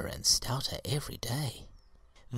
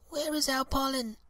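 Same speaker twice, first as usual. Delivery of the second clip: put-on very high eager baby voices; eager babies. A narrator's reading. The baby seeds ask Willy for pollen, high and eager. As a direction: squeak it out like tiny seedlings. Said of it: "the babies cried eagerly".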